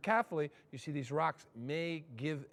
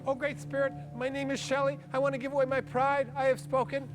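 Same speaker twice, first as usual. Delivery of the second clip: Shelley praying; 8 semitones higher, 3 dB louder than usual. Retold or imitated. People praying in turn.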